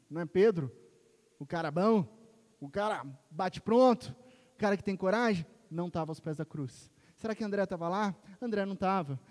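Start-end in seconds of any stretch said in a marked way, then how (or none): 0.1-5.5 mock incredulous. Mocking the brave Peter.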